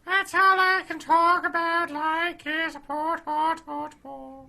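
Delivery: high, loud leprechaun voice; sick leprechaun. Pretend. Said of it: "Sound like a sick leprechaun".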